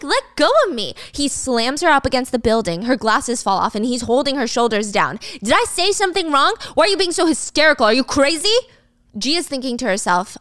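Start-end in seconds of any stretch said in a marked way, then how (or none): 0.0-0.9 higher-pitched, indignant demand; urgent protest. Acted. Acted girlfriend grabbed, demanding release. 5.4-8.6 higher, rapid-fire accusing questions; angry berating. Acted. Acted boyfriend screaming at pinned girlfriend.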